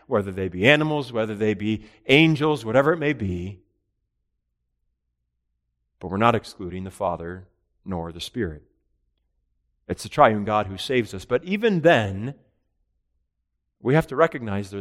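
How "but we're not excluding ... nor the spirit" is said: ordinary talk, nothing marked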